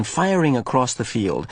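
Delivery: ordinary delivery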